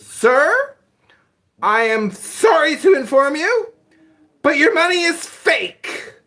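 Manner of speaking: high, loud, slow prissy manager voice; hammed-up formal apology; imitating the manager. Mimicking the restaurant manager telling him his money is fake, high and prim. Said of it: "and he goes".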